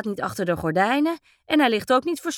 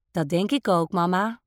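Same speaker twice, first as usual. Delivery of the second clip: ordinary delivery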